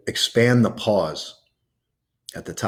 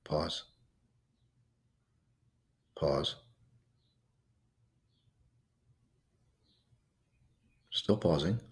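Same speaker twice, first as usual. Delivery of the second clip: very soft, barely voiced breath-hold prompts; gently murmured counts while the breath is held; hushed pause cues; 4 semitones lower, 10 dB quieter, slower than usual. Shown as a demonstration. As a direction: softly guide the held breath. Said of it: "So it'll be like this".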